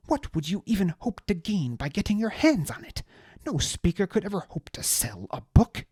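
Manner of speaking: high, loud, outraged aristocrat ranting; indignant high-voiced noble. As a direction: spit out the betrayal in a high indignant voice. Deliritus voice.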